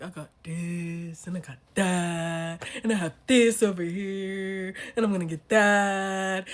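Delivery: drawn-out, swelling boast; mocking braggart. Imitating men bragging about their things, slow mocking boast.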